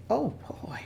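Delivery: soft, breathy sigh of dismay after a fall; hushed, tired oh boy; weary whisper. Acted. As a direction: whisper it to yourself, shaken. Mother sighs after falling.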